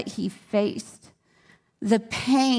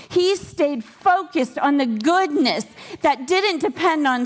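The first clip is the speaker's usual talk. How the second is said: excited preaching; 6 semitones higher than usual. Real, not acted. Preacher's rising praise climax.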